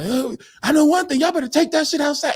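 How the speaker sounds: high, fast mock telling-off; acted scolding. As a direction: lay into them like the fed-up sober one. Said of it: "Debbie lit into everybody".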